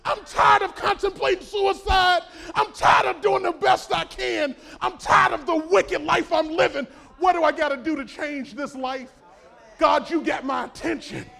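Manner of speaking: loud, high, crying-out preacher; shouted preaching. Acted. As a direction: cry it out as the broken jailer. Preaching the jailer's plea.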